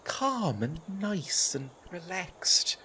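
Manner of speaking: slow, near-whispered demonstration of calm; calm and relaxed. Shown as a demonstration. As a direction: draw it out softly, showing how relaxed you are. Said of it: "I'm trying to be a little bit more".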